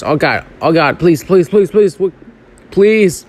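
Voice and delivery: panicked pleading; frantic begging under pressure; panicked rookie. Real, not acted. Dodging stars in the game, pleading.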